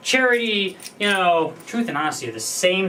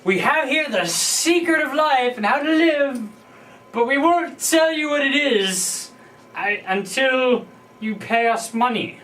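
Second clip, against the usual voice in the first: mock guru salesman; 7 semitones higher than usual. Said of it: "It's like".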